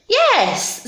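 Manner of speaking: high-pitched, eager agreement; witch's eager yes. Acted. Witch welcomes the frog aboard, high eager voice. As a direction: answer high and quick as the witch. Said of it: "said the witch".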